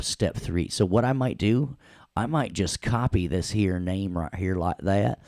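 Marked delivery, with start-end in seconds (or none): 4.3-5.2 playful Southern twang; hammed-up Southern US accent. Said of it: "You do that Southern accent way too good".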